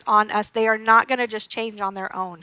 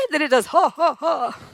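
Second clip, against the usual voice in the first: normal talking voice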